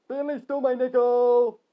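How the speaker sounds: high, loud accusing yell; shouting. Acted. Harry accusing Finley, high loud yell. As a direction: shout it, stretching the end.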